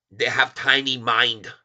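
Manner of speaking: put-on Chinese accent in broken English, mocking; a teasing Chinese accent for the shorts. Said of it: "make fun of people talk like this".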